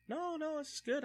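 high, sweet imitation of the polite girlfriend. Polite girlfriend voiced in story.

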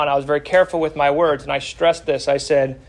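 ordinary delivery

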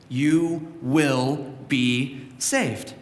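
each word drawn out, very slow; slow emphatic promise. Acted. Preacher telling the church they will be saved, very slow and emphatic. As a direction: land it like a promise. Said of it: "bold letters, underscored".

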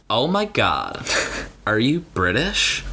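slow, drawn-out camp gush; gushing like an excited stranger at a bar; camp Bruno impression. Friend imitating Bruno calling over their shoulder, lightly camp.

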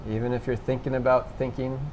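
soft, low-key meditation guidance with long pauses; guided meditation. Real, not acted. Instructor guiding listeners to notice thoughts and sensations, quiet meditation voice. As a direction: gently prompt them, leaving long silences. Leads a meditation after breathing exercise.

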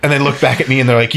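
normal talking voice

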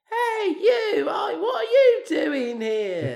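put-on shouted, high Cockney kid yelling through laughter; hammed-up Cockney boy shouting; Cockney accent. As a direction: belt it out like a cheeky London kid in Cockney. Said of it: "just Cockney".

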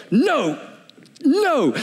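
a hint of a stubborn, angry kid; mad kid. Preacher imitating a mad kid refusing, with a rising second no. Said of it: "been so mad like a kid".

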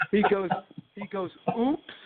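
high mock-innocent "Oops"; playful vendor's oops. Vendor dropping a hot dog, high mock-innocent oops. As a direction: play the vendor dropping the dog a treat on purpose.

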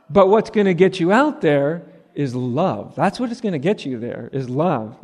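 ordinary delivery

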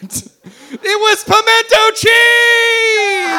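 high, loud, drawn-out wail; hammed-up shouted confession; anguished outburst. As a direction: burst out and stretch the last word. [name] breaks down and confesses.

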